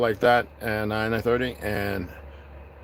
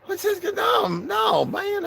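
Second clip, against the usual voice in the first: very high, shrieking hammed-up cry; squealing mock shock; much slower than usual. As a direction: shriek it out in fake alarm. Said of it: "I was like".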